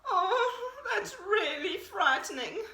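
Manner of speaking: high quavering fright; frightened deer. A narrator's reading. Deer hears of the wolf, high and frightened. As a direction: say it shaking with fear.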